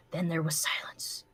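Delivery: hushed dramatic whisper; whispered silence. Acted. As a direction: whisper it after the blast. Dramatic hush after explosion.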